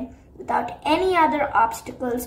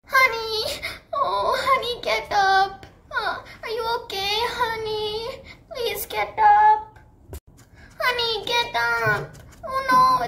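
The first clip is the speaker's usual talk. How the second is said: much higher, crying plea to get up; tearful pleading; 8 semitones higher than usual. Acted. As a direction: sob and beg your hurt friend to wake. Sunny crying over hurt friend.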